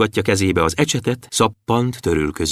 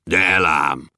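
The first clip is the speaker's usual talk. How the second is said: much lower, thick dwarf voice; low gruff dwarf promise; 6 semitones lower, slower than usual. A narrator's reading. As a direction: rumble the promise deep and sure. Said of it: "ígérte vastagon Bobolysa is".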